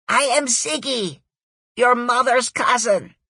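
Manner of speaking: put-on loud, raspy, high German-Aussie accent; German-Australian accent. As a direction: shout it in a thick German-turned-Aussie accent. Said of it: "through Ziggy's German-turned-Aussie accent".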